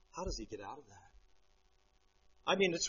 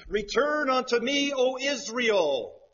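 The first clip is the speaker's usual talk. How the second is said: high, loud, drawn-out call; God's pleading call; 8 semitones higher, 5 dB louder, slower than usual. Retold or imitated. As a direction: call Israel home slowly.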